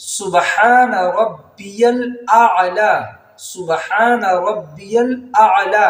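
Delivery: سبحان ربی الاعلی slow; correct recitation. Shown as a demonstration. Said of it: "سجدے والی تسبیح پڑھنی رہتی ہے". (صحیح آہستہ تسبیح)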